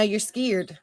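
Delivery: "scared" as skeerd, long ee; slang pronunciation. Shown as a demonstration. Host insisting on the slang version, "scared" as skeerd. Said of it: "we say skied".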